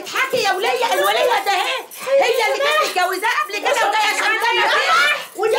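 high, loud indignant shouting; outraged scolding. Acted. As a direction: shout her down for laughing at you. Outraged scolding over the laughter. (زعيق بغضب واستنكار)

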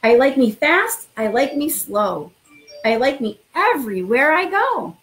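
expressive storytime read-aloud; reading to children. Acted. Principal reading a picture book to new students, lively storytime voice. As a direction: read it like bedtime story time for little kids.

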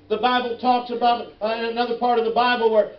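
normal talking voice

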